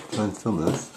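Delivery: ordinary talk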